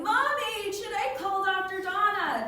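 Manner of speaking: high-pitched imitation of a kid; put-on anxious kid voice; child calling mom. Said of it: "I have one kid going".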